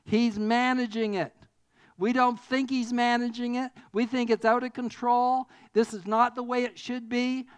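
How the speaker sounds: loud, rising sermon climax; preaching at full force. Acted. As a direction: drive the point home to the congregation. Preaching the middle point at full force.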